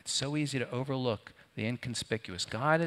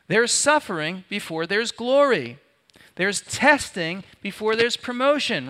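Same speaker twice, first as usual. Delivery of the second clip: higher and louder, preaching at full force; fiery preaching; 10 semitones higher, 9 dB louder than usual. Acted. Pastor preaches suffering before glory to the congregation, fiery. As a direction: drive the point home to the congregation.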